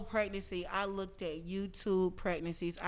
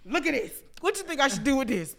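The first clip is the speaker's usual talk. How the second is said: higher, louder and quicker, showing off a find; hyped-up self; 6 semitones higher, 7 dB louder, faster than usual. Retold or imitated. Showing her friend something wild, excited and loud. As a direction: rush it out to your friend. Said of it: "I'd be like".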